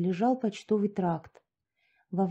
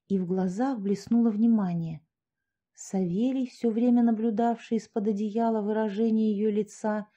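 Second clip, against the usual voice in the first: ordinary delivery, nothing marked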